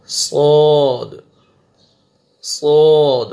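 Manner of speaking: صاد loud, thick, rounded and drawn out; heavy saad. Shown as a demonstration. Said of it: "موٹا کر کے پڑھیں گے". (موٹا کر کے صاد)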